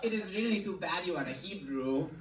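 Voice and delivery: regretful Russian suitor; Russian man's rueful aside; Russian accent. Acted. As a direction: let her down in a soft Russian accent. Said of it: "that's what Russian people sound like".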